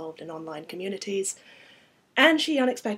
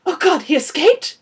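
high, loud panicked outburst; alarmed, raised cry; hyperventilating panic; 10 semitones higher, 16 dB louder than usual. Acted. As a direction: cut in with sudden dread. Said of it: "I was starting to hyperventilate".

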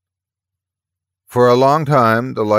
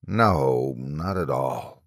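low, soft murmur; soft, low-pitched denial; 6 semitones lower, 6 dB quieter, much slower than usual. A narrator's reading. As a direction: murmur the denial slowly trailing down. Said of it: "the man murmured".

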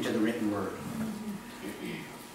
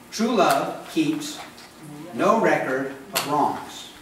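slow emphasis; much slower than usual. Acted. Slow emphatic scripture line.

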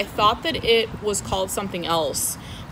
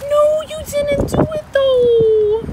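very high, loud, drawn-out squeal; squealing disbelief; 6 dB louder, slower than usual. Real, not acted. Plant lover reacting to a friend's surprise gift, squealed disbelief. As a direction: stretch it out in thrilled disbelief.

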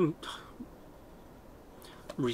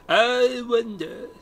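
Ollivander impression; 4 semitones higher than usual. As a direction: draw out I wonder like Ollivander. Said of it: "I turned into Ollivander".

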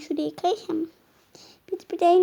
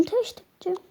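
ordinary talk

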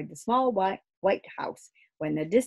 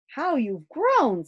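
slow, higher, fond exclamation; fond father; 8 semitones higher, 5 dB louder, slower than usual. Acted. Father home on leave to his children, fondly slow. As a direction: marvel at the children, slowly.

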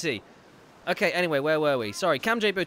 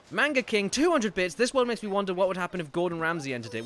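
ordinary talk, nothing marked

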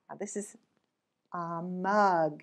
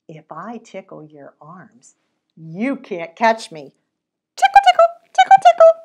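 put-on taunting child; 13 dB louder than usual. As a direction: tease the monster brightly. Story taunt, slow then squealed.

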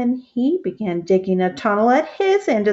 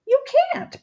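very high squealing mock bellow. A narrator's reading. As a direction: top of his voice, cartoonish. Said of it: "bellowed Sammy".

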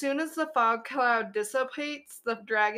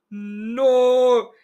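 put-on sudden much louder shout in a made-up accent; 12 dB louder than usual. Wolf-druid shouting at the dragon to stop, desperate yell. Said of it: "the voice I made for that druid".

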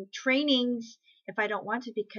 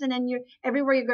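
ordinary talk

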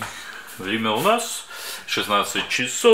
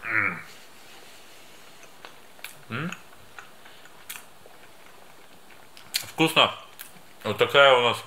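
eating and speaking at once; talking while chewing; 6 dB quieter than usual. Real, not acted. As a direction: mouth partly full of meat. Seen 2: Bites meat just before, cheeks bulge and chews while holding it.

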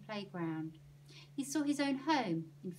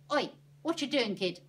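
loud rough call; gruff shout; 4 semitones higher, 9 dB louder than usual. Acted. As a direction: bark it at the kid. Stallholder yells at Stan.

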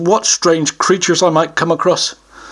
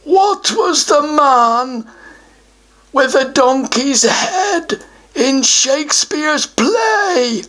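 pompous, higher troll voice; a self-important troll puffing himself up; troll guardian; 9 semitones higher, much slower than usual. Acted. Troll announces he guards the treasure, pompous higher voice.

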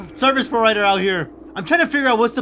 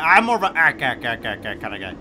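mock laugh guy; 9 semitones lower than usual. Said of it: "He's like".

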